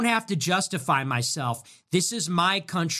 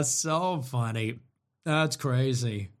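ordinary delivery

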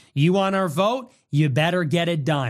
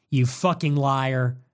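ordinary talk, nothing marked

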